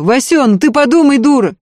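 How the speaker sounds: angry shouting. A narrator's reading. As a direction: call furiously after the woman leaving. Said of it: "зло и жадно смотрел".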